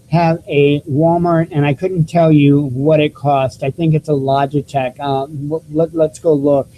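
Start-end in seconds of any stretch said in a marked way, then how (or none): none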